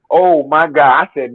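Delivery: ordinary talk, nothing marked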